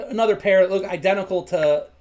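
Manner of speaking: ordinary delivery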